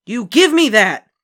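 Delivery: put-on high, loud, slow boy demand; angry demand. A narrator's reading. Boy demands his lollipop back, angry.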